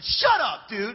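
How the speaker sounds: retold sharp retort; snapping back.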